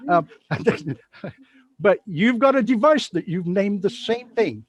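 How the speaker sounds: ordinary talk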